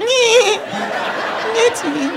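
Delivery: tiny, soft, high squeak; high Doughboy voice. Acted. Comic's Doughboy announcing himself. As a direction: pitch way up and go small. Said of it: "It's more like".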